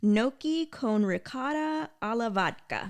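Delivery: Italian accent, savoring each word; rolled out in an Italian accent. Shown as a demonstration. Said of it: "Pretty good Italian accent there".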